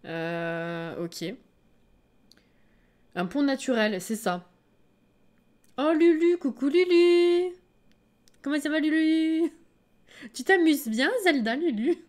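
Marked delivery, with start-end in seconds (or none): 5.8-12.0 pet talk. Real, not acted. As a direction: coo at the cat. Talking to her pet. (voix toute douce pour l'animal)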